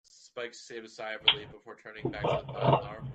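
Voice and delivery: ordinary delivery, nothing marked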